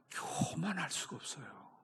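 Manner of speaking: whispered, unvoiced "교만할 수가 없어요"; hushed whisper that sets up the shout. Real, not acted. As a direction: breathe it out as a secret. Preacher whispers then shouts.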